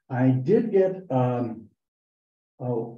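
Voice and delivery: ordinary talk, nothing marked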